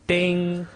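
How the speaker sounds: high sung ding; microwave ding sound. Pretend. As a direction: chime like a microwave. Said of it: "That was an impression of a microwave".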